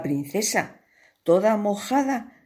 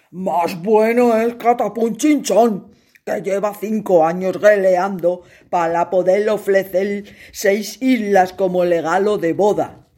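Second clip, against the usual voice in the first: louder, gruff scolding king; stern king; 5 dB louder than usual. Acted. King scolds his daughter, loud and stern. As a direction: scold your silly daughter.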